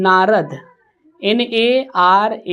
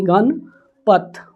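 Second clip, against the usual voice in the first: ordinary talk, nothing marked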